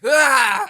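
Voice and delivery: loud high mock yell; mock battle yell; imitated attack cry. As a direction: yell it like her attack sound. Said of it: "a lot of the same, like, inflection".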